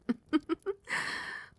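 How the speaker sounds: short, airy giggle; pleased chuckle. Acted. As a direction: chuckle coyly at the offer. Queen pleased by pet's offer.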